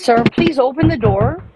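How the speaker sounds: usual speaking voice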